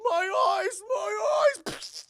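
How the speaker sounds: high, loud crying shriek; hammed-up tortured howl; agonised wail. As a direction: scream it in mock agony. [name] in agony, acted.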